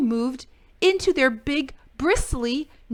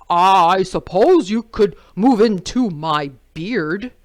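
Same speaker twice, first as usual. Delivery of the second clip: louder, gentle lumberjack voice; Jim's offer; 11 dB louder than usual. A narrator's reading. Jim offering his beard to the porcupine, big voice. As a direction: offer it kindly but loud. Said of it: "he said".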